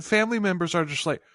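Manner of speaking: usual speaking voice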